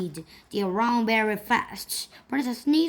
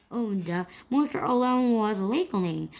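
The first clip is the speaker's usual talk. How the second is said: plain reading voice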